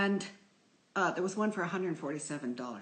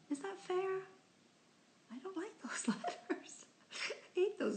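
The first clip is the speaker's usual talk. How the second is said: high mock outrage; 14 semitones higher, 5 dB quieter than usual. Real, not acted. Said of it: "her own complaint about chain letters".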